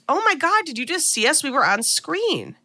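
lightly voiced excited daughter spotting herself; excited gasp. Daughter seeing herself on TV, mildly excited. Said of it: "Denise sits up and goes".

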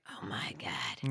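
hushed, breathless whisper of shock; whispered awe. Retold or imitated. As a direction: whisper it like you just spotted the monster. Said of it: "when he's like".

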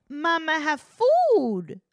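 high, loud mock baby talk; broken toddler-style coo, up high. Imitating how she talks to her child, exaggerated baby talk. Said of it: "Like, that's how she talks".

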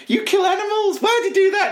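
high squeaky mock protester. Protesters asking Borat, high mock outrage.

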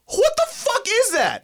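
high, slow shocked outburst; stunned disbelief. Real, not acted. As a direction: react in total disbelief. Reacting to the nerd voice.

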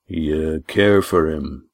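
low, slow, gentle question. Acted. Walt gently asking Lindy about her feelings, low and slow. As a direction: ask it tenderly.

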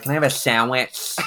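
sandwich slurred to "shamwich"; sister's mispronunciation. Retold or imitated. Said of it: "like my sister who was like".

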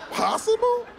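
shrieking high disbelief; incredulous. Acted. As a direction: echo it in outrage.

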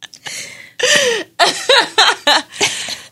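long high-pitched laugh. Real, not acted. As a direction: let a real laugh ring out. After laughing plea.

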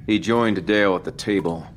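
ordinary delivery

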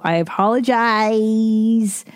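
slow, stretched final word; drawn-out apology. Real, not acted. Host apologizing to listeners for missing guest, drawn-out. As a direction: hold the last syllable out long.

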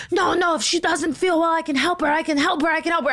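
high-pitched mock insisting, repeating in a rush of urgency; hammed-up high frantic voice; imitated frantic woman. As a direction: mimic an unhinged woman pleading to help.

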